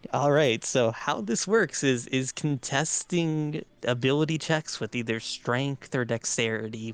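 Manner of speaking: ordinary talk, nothing marked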